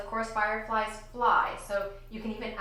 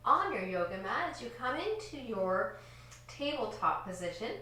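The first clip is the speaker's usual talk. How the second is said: ordinary delivery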